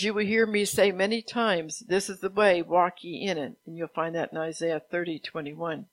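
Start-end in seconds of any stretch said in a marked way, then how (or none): 0.0-3.6 firm, projected proclamation; prophetic proclamation. Acted. Preacher delivers God's word on the journey, proclaiming. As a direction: declare it with God's authority, opening phrases louder. Reads out God's words to congregation.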